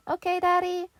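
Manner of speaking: put-on high, small kid voice; a kid answering his dad; child voice. A narrator's reading. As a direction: say it like an obedient little boy. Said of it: "Red said".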